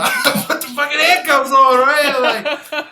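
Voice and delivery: laughing. Real, not acted. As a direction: break into real laughter mid-line. Laughing while telling the story.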